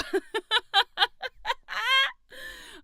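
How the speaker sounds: high burst of laughter; loud laugh. Real, not acted. Streamer laughing after yelling at chat. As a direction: cackle at yourself.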